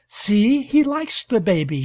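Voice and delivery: high, loud excited cry; cried out. A narrator's reading. Girl to her father, delighted by the dog, excited cry. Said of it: "she cried".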